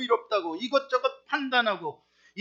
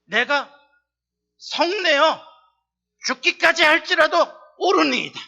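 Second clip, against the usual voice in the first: defiant Jonah; 6 semitones higher, 7 dB louder than usual. Retold or imitated. Preacher voicing Jonah defying God, loud and stubborn. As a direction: hurl it back at God stubbornly. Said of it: "이렇게 말해요. 잘 들어보세요".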